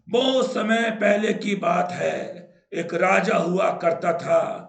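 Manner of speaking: loud, slow mock storytelling; storybook recitation. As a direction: recite a fairy-tale opening like a schoolbook. Mimics storybook translation passage.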